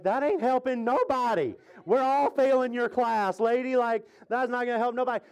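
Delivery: high-pitched, worked-up student complaint; hammed-up exasperated retort; exasperated mock protest. Acting out student's complaint to teacher.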